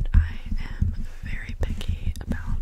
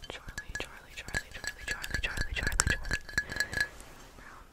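repeated trigger word; 10 dB quieter than usual. Acted.

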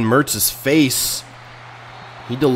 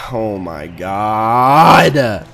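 dismayed groan; 2 semitones lower, 3 dB louder, much slower than usual. Real, not acted. Dismay at game turn.

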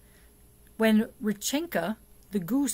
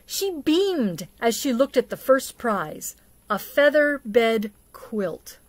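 story narration. A narrator's reading. Reads the Babushka story aloud.

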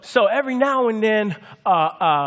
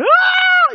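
piercing scream, far higher and louder; toddler's tantrum scream; 10 dB louder than usual. Retold or imitated. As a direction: shriek like a two-year-old losing her show. Toddler screams when TV turned off.

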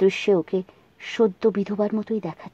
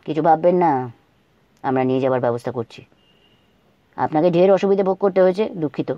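gentle consoling; 5 semitones lower than usual. Acted. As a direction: speak gently to a grieving woman. Abhra consoles the widow.

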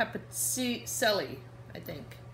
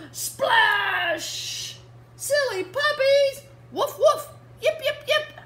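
much higher, louder playful splash and barks; 11 dB louder, much slower than usual. Acted. Reading to kids, the water splashes and puppies yap. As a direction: sound out the splash with glee.

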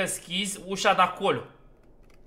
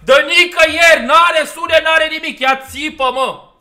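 real startled yell, much higher and louder; screaming in real fright at the game; frightened scream; 11 semitones higher, 20 dB louder than usual. Real, not acted. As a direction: shriek in genuine panic after a jump scare. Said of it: "ce m-a speriat".

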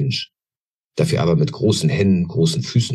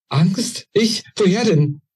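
brittle voice; 14 semitones higher, slower than usual. A narrator's reading. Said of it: "wie brüchig sie war".

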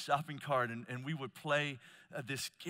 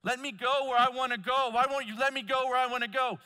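put-on high, loud, pleading kid's whine, faster at the end; whiny frustrated kid; 7 semitones higher, 5 dB louder than usual. Kids in the cart protesting to their dad, high whiny plea. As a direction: plead like a child stuck in the cart. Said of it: "See, they're all frustrated".

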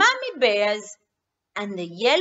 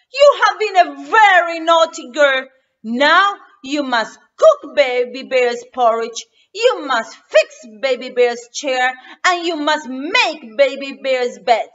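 much louder scolding; angry Daddy Bear; 11 dB louder than usual. Acted. Daddy Bear scolds naughty Goldilocks, angry and loud. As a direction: scold her hard. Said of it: "Daddy Bear is very angry".

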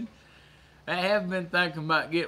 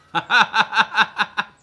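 loud, high cackle; hearty laugh; 6 semitones higher, 13 dB louder than usual. Real, not acted. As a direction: explode into rapid staccato laughter. Laughing at scaring Grandma.

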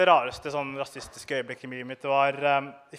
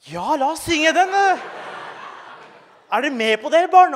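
high, loud hammed-up enthusiasm; mock excited teacher; 12 semitones higher, 8 dB louder than usual. The teacher hypes the class to sing, hammed-up and high. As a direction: gush like an overjoyed teacher. Teacher voiced cheering in story.

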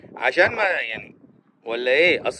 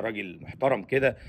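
ordinary talk

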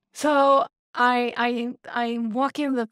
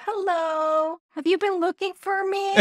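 cat voice; 8 semitones higher than usual. Retold or imitated. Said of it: "And he's like".

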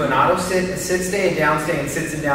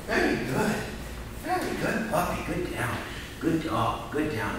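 soft praise; 10 dB quieter than usual. Real, not acted.

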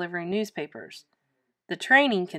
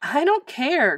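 put-on higher, upset boy's protest; raised-voice refusal; defiant cry; 6 semitones higher, 4 dB louder, slower than usual. A narrator's reading. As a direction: cry it out stubbornly. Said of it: "cried Louis".

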